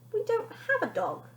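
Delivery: very high puzzled remark; mother puzzled at father; confused. Acted. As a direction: say it bewildered.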